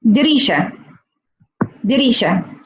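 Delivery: dirisha repeated with a falling tune; Emirati pronunciation. Shown as a demonstration. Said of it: "we say".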